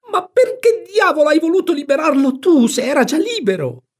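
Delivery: very high, loud screech; exasperated shriek. A narrator's reading. Aunt asks why free a free man, exasperated shriek. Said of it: "strillò zia Sally".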